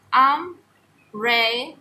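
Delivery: umbrella stretched very slowly, syllable by syllable, ending on a rise; syllables drawn out. Shown as a demonstration. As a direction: slowly split umbrella into its three parts. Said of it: "It has three syllables".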